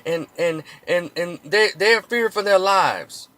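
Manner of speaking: high, slow whiny mock plea; mocking sympathizer. Mocking educated blacks defending cops, high slow whiny voice. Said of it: "try to give sympathy".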